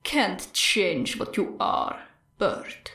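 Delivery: low, slow, soft menace; a killer's taunt, low and slow; menacing villain voice. Acted. Stain's menacing voice in her head.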